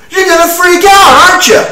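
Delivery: building from high loud to a screamed curse; real anger spilling over into a shout; furious rant. Real, not acted. Ranting about his arrest, building to a furious shout.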